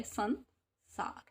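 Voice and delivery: संसार drawn out slowly, nasal ं sounded; slow syllable drill. Shown as a demonstration. Teacher sounding out संसार for pupils, slow. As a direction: spell out संसार slowly so the ं is heard. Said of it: "अंक की sound आ रही है".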